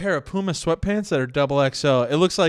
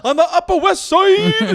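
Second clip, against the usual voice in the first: high, loud, slow rapper shout; gangster rapper; 14 semitones higher, 7 dB louder, slower than usual. Pretend. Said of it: "gangster rap album".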